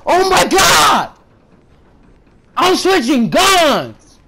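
high, exasperated gamer outburst; real high-pitched frustrated shouting; frustrated yelling. Real, not acted. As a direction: yell in outrage at the kill. Frustrated outbursts at being killed.

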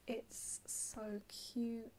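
usual speaking voice

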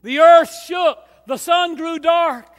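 loud, high, ringing preacher's cry; full-force preaching. Acted. Preacher rallying the church toward Sunday, loud high cry. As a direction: lift the congregation with it.

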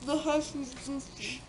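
slow, garbled through stuffed cheeks; mouth full. Real, not acted. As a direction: drag the words out round a mouthful. Mouth crammed with marshmallows.

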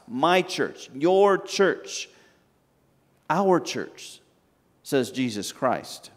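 usual speaking voice

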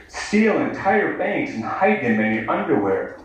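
normal talking voice

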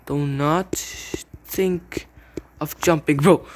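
drawn-out word-by-word warning; very slow, deliberate command. Real, not acted. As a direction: stretch each word with pauses between. Slow warning to opponent, then repeated fast.

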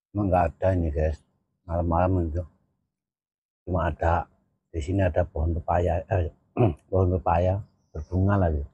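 speaking while eating noodles; pausing mid-meal to talk; talking between bites. Real, not acted. Seen 1: Mid-meal, scooping noodles between bites; plain talk in R frames.

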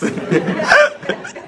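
high rising giggle; giggling laugh. Real, not acted. Priest giggling about eating eggs, high giggle. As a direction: break into a giggle.

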